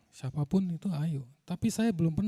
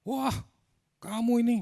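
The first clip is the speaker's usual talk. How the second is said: retold crowd yelling; 6 semitones higher than usual. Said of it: "Sambil mereka teriak-teriak".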